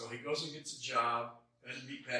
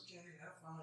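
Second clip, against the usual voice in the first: put-on little girl; 5 semitones higher, 11 dB quieter than usual. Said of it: "She goes".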